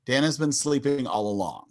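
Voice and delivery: ordinary delivery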